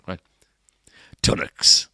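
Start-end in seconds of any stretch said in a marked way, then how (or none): 1.2-1.9 put-on northern accent. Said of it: "I'll do it in a northern accent".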